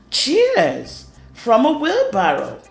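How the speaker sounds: ordinary delivery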